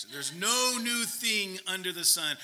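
slow, hammed-up Scottish accent. Preacher retrying his old pastor's saying, exaggerated Scottish accent. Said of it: "would say in a Scottish accent".

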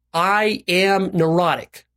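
slow, measured line to repeat; deliberate recitation. Shown as a demonstration. Coach giving listeners a line to repeat, deliberate recitation. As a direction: state it slowly for others to echo.

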